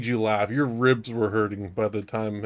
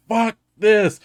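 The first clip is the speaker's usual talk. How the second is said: angry outburst; 8 semitones higher, 4 dB louder than usual. Retold or imitated. Friend raging then quitting party.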